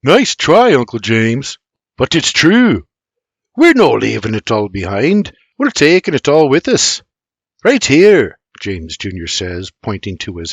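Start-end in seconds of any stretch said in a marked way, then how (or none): 0.0-2.8 put-on higher, louder dismissive boy; a hint of a sniffy teen. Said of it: "William sniffs". 3.6-8.4 Irish man voice. Acted. James Junior character voice.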